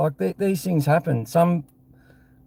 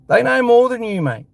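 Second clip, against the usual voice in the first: heated retort; 7 semitones higher than usual. Real, not acted. Angry rant at a critic.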